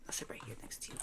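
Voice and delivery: very soft, breath-only whisper; real hushed whisper. Real, not acted. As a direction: whisper low to someone close by. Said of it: "Whisper, whisper".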